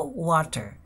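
"water" as "wa-ter", open a, t and final r sounded; variant pronunciation. Shown as a demonstration. Teacher showing learners a further way, "water" as "wa-ter". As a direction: say "water" with an open first vowel and clear t. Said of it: "se pueden decir de diferentes maneras".

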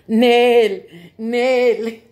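loud, drawn-out goat bleat. Acted. Storyteller as the goat refusing to help, loud bleating. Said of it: "lo que dijo el pinche chivo".

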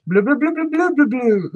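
high, gurgly blue-blue fish babble; bubble speech. Pretend. Crafter doing the fish's bubble language, high babble. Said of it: "That's bubble speech".

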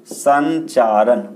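संचारण stretched very slowly, syllable by syllable; teacher dictating संचारण slowly; slow dictation. Shown as a demonstration. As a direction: draw out संचारण so students can write it. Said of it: "propagation का मतलब क्या होता है बच्चे?".

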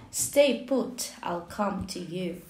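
ordinary delivery, nothing marked

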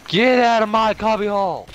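high loud shout; angry yell. Real, not acted. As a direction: yell to chase him out. Yelling at an enemy in his spot.

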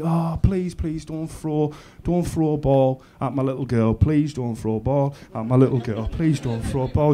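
low, hushed anxious plea; quiet pleading. Retold or imitated. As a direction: plead under your breath, as if replaying a worry. Retold silent pleading of anxious parent.

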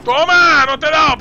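high, loud exclamation; loud throwing shout. Real, not acted. Player throws at enemy and misses, loud shout. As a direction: shout it as you hurl.

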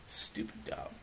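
unvoiced whispered insult. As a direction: hiss it under your breath. Muttered insult.

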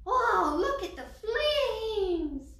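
high, loud, drawn-out admiration; excited marvelling. A narrator's reading. Teresa admires her new lowrider, thrilled high exclamation. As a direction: exclaim it slowly, stretched with delight.

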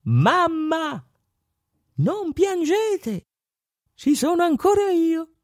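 put-on very high, slow squeaky child voice; tiny little voice. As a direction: comfort mamma in a tiny high voice. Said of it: "si sentì una vocina".

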